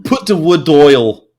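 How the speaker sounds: laughing mimic of the friend's broad Yorkshire. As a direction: imitate a friend's broad Yorkshire, slowly and loud. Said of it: "And he'd say".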